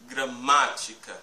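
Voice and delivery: Gramática with MÁ loud and long; Gramática, strong "má", light ending; stress on third-last syllable. Shown as a demonstration. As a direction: punch the "má" in Gramática. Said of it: "c'est l'avant-pénultime, euh, syllabe la plus forte".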